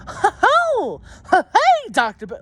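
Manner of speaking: high, hammed-up fake cheery hello; stupid smiling act. Mocking the teacher's fake smile at Doctor Bennett, high cheery voice. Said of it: "put on a stupid smiling act".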